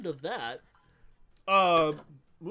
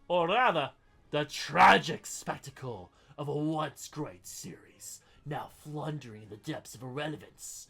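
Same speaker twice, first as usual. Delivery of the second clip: theatrical critic reading with mounting outrage; mock dramatic review reading; 3 semitones higher, 10 dB louder than usual. Podcaster reading a viewer's harsh review, theatrical. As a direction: read the scathing review like a pompous critic.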